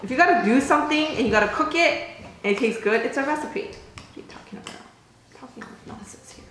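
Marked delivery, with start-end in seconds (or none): 3.6-6.4 whisper. Real, not acted. Whispered aside to herself.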